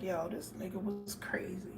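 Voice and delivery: hushed unvoiced whisper. Uncle whispering to himself about his conspiracy-minded nephew. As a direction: breathe it out as a secret aside.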